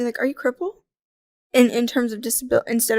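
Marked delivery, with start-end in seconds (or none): 0.2-0.7 imitated innocent asking, slightly rising; softly put, guileless query; innocent question. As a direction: ask it as a genuine, harmless question. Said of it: "said to me innocently".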